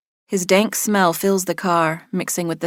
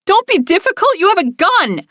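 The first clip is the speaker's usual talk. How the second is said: much higher, louder terrified shriek; 12 semitones higher, 4 dB louder than usual. A narrator's reading. Captive woman shrieking at her armed kidnapper, terrified. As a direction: shriek it in disbelief and terror. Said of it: "I shriek".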